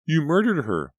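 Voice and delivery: high horrified outcry over a body; terrified cry. Acted. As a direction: scream it in horror at the killer. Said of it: "Pam cried".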